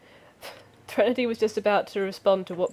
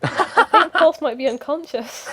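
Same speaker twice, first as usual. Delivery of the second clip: high, loud laughing disbelief; laughing in shock; 8 semitones higher, 6 dB louder than usual. Real, not acted.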